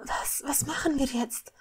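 soft, halting whisper; hushed, uneasy question; anxious whisper. A narrator's reading. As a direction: whisper it with a worried stumble. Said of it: "flüsterte".

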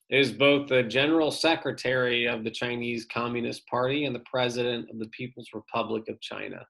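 usual speaking voice

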